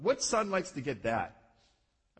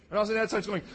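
ordinary talk, nothing marked

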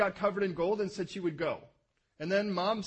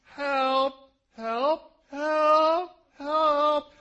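hammed-up pleading help, louder; comic help cries; mock cries for help; 7 semitones higher, 7 dB louder, much slower than usual. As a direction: plead like a scared girl. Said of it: "She's probably thinking".

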